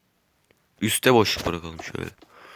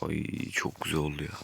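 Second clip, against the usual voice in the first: soft put-on voice; 5 semitones lower, 12 dB quieter than usual. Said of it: "Neyim ben ya?".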